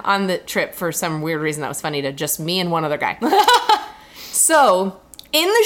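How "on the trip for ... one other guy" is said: ordinary delivery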